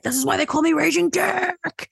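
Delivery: high, loud mock rage; furious. Enraged brother announces his nickname, in loud, high mock fury. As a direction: bellow the nickname like a hammed-up furious villain. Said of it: "and he's furious".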